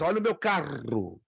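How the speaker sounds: southern accent, "carro" with a trilled R; southern Brazilian accent. Shown as a demonstration. Teacher mimicking a southerner in a southern Brazilian accent. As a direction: say it like a sulista, rolling the R. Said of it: "ou é hispano ... ou é sulista".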